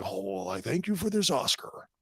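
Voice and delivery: slow, drawn-out put-on character voice; put-on film character acceptance speech. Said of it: "he didn't get up there going like".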